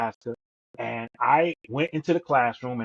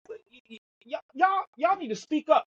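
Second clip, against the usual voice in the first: pitched way up, exasperated demand to speak up; replaying his own frustrated teacher moment, high and sharp; retold angry outburst; 12 semitones higher than usual. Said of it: "I'm getting angry".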